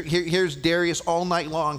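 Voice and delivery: ordinary talk, nothing marked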